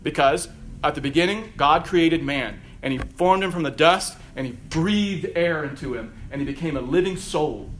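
normal talking voice

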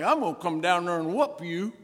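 lightly voiced country radio prankster; Roy D. Mercer taunt. Radio prankster riling up a caller, mock threat. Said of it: "he'd say something like".

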